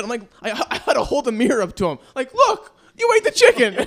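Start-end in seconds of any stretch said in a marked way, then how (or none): 2.3-3.8 much higher, loud, breaking into laughter; laughing exclamation. Retold or imitated. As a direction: cry it out while cracking up. Holding mirror up, laughing.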